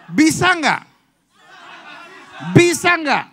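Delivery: "Bisa nggak?" flung out loud to the congregation; rally the crowd with "Bisa nggak?" loud; loud rousing question. Shown as a demonstration. Preacher rouses the congregation to answer, loud challenge. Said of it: "Belum, saya belum nanya".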